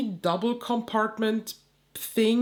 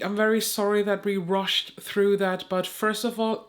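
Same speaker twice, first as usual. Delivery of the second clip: normal talking voice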